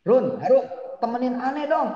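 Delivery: hammed-up pleading with Harun; mock Musa begging. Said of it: "Musa nggak mau ngomong begitu".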